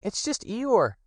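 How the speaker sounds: high, small piglet voice; high and slow little Piglet. Acted. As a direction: squeak it out like a tiny worried piglet. Piglet comforts Pooh.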